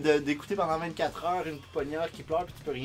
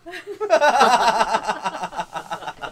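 a big burst of high laughter; loud laugh; 7 semitones higher, 8 dB louder than usual. Real, not acted. Host cracks up at the teasing: loud laugh.

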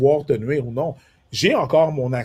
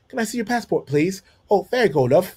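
higher-pitched London English; London English accent; 6 semitones higher than usual. Acted. Airport officer greeting him on landing in London, London accent. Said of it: "puis elle va me dire".

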